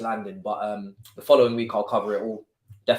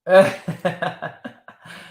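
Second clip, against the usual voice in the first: loud laughter; 6 semitones higher, 6 dB louder than usual. Real, not acted. Laughing at hype.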